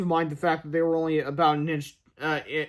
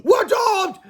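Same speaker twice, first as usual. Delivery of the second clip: high, loud wail of doom; hammed-up high-pitched alarm; doomed shout; 7 dB louder than usual. A narrator's reading. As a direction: yell it as if all is lost. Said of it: "shouted Cheeseball".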